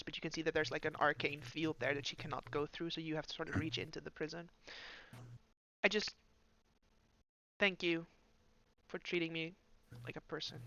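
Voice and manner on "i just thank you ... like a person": quiet, halting grateful voice; hesitant soft thanks; soft-spoken prisoner. Acted.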